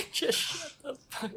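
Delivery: very high laughing squeak; laughing echo. Retold or imitated. Echoing the retort laughing.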